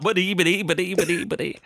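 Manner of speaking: high mock stammer, ba-dee over and over; cartoon pig stammering, hammed up; Porky Pig stutter. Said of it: "come on, Porky Pig, spit it out".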